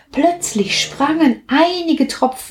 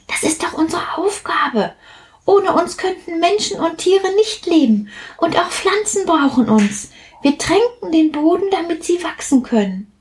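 cheerful reassurance; 10 semitones higher than usual. Acted. Platsch reassures his friend.

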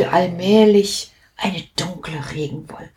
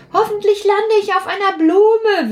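high, hopeful little drop voice; wishful; 13 semitones higher than usual. Acted. Sprüh hopes to land on a flower, high and hopeful. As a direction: wish it dreamily, pitched high. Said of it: "wünschte sich Sprüh".

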